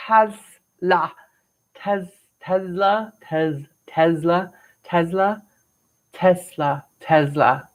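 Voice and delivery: Tesla said over and over, testing the middle sound; saying Tesla. Shown as a demonstration. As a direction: try out Tesla slowly, feeling the s or z. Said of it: "okay, I say Tesla because that's just my accent".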